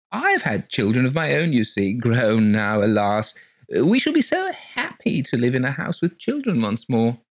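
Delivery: high, gushing genteel lady voice; gushing landlady. Acted. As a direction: pour it out sweetly and breathlessly high. Mrs Colgate gushing.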